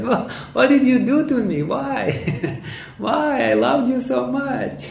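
hammed-up hurt aunt, giggling; aunt's outraged wail. Aunt cries out at the nephew who bit her, laughing high mock wail. Aunt confronts boy after ear bitten.

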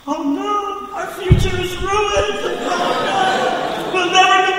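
put-on very high, loud childish wail; mock panic. Boys caught by the railroad cop, high mock panic. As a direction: squeal like a terrified little kid. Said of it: "we were just like".

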